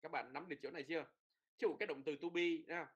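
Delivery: ordinary talk, nothing marked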